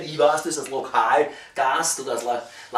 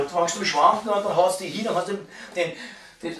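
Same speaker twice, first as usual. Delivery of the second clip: ordinary talk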